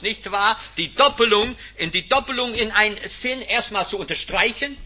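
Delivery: ordinary talk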